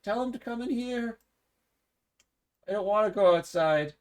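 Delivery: timid lady refusing to go out; Glisa calling. Acted. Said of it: "Glisa calls out".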